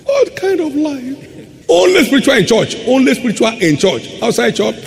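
high-pitched, incredulous mock scolding; mocking exclamation. Preacher chiding church-only Christians, high mocking voice. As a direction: pitch it way up, teasing the hypocrites.

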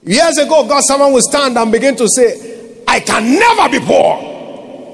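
high, forceful pulpit delivery; full-force preaching. Real, not acted. Pastor teaching that thinking rules speech, full-force preaching. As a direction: drive it home to the congregation.

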